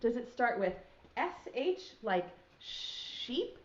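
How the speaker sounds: ordinary talk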